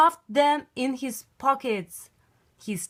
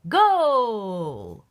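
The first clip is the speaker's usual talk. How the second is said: goal shout; 5 dB louder than usual. Acted. Team scores a goal.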